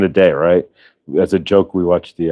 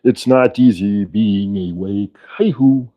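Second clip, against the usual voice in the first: slow sung parody in a puppet frog voice; Kermit; 3 semitones higher, slower than usual. Acted. Doing the show as a puppet frog, slow singsong impression. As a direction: croon it slowly as the frog puppet. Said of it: "we could do the show in Kermit".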